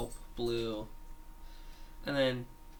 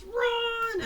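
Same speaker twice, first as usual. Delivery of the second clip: shouting; 5 dB louder than usual. Retold or imitated. Child acting out cartoon shout.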